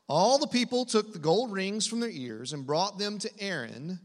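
ordinary delivery

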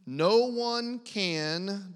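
slow leading cue for the congregation; slow drawn-out prompt. Shown as a demonstration. Pastor drawing out the verse for the church to finish, slowly. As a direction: stretch each word out, inviting the room to finish. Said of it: "Read it for me".

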